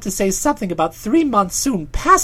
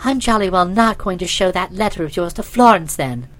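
lower, put-on female character voice; defiant lady, pitched down; Nobby, defiant young woman; 7 semitones lower than usual. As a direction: threaten him in a lady's voice. Nobby threatens Bertie.

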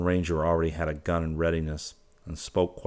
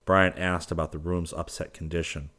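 plain reading voice

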